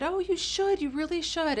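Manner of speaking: eager encouragement. Retold or imitated. Retold encouragement to a woman.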